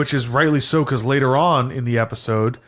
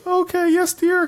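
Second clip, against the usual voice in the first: much higher, sugary "okay, yes, dear"; mock high sweet voice; slower than usual. [name] mocking his compliance in a high voice. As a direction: squeak it out like a meek servant. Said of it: "And he goes".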